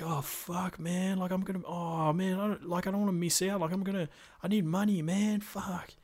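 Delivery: high, worried mock voice; panicking character.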